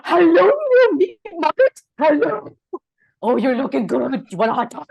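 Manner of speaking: high squeaky voice for a pet. Acted. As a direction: coo at a dog like a baby. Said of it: "it's his animal voices".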